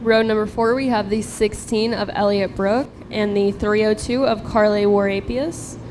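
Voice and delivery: ordinary delivery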